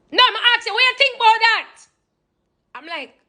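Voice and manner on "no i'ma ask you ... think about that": high, insistent Jamaican patois mother; a Jamaican mom demanding an answer; pressing Jamaican mom. Retold or imitated. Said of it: "And I'm like".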